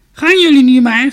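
put-on high, loud, creaky old woman's voice; shrill-pitched storybook crone, full voice. A narrator's reading. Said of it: "riep een krakerige stem".

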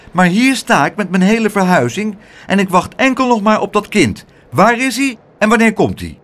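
put-on harried woman demanding the child; impatient aunt. Aunt complains to Pluk about her move, impatient and high.

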